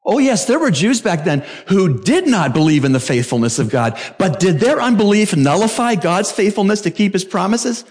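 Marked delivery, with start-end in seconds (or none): none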